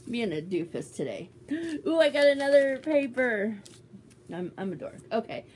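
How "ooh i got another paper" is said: excited squeal. Real, not acted. Excited squeal over gift.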